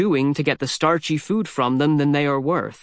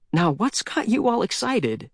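put-on boy voice; 7 semitones higher than usual. A narrator's reading. Boy talks to startled raccoon.

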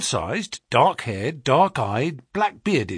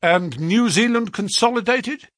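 higher, louder, quick quizzing; brisk questioning agent; 9 semitones higher, 4 dB louder than usual. A narrator's reading. Agent checks the clerk's past, brisk and prying. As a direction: fire the question like a busy agent.

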